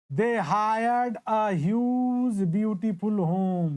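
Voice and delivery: very slow, each word spaced out; slow dictation. Shown as a demonstration. Teacher repeating the example sentence for students, very slowly. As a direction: repeat it slowly so everyone writes it down.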